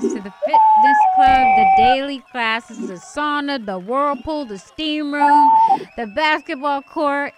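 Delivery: excited. Real, not acted. Host announcing prize, building up.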